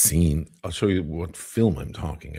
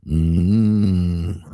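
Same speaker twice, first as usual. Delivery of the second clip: a louder, low "Mm" hum; low chest hum; 6 dB louder than usual. Retold or imitated. Actor warming his voice with a chest hum. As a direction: hum deep as if pounding the chest. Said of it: "and he'd go".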